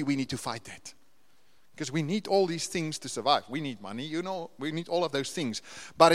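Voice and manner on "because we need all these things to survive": normal talking voice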